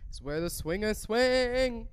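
slow, put-on seductive resort pitch; sultry ad voice. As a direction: tease it like a cheesy commercial. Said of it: "I don't know why I'm doing this".

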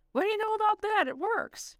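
high, delighted exclamation; thrilled the old machine still runs; excited surprise. Acted. Lamont amazed the cabinet works.

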